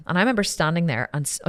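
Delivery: ordinary talk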